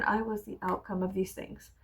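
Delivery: ordinary delivery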